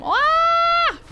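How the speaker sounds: sudden high yelp of alarm; sharp high cry of surprise; startled shriek. Real, not acted. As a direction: shriek as you get hit. Startled by attack.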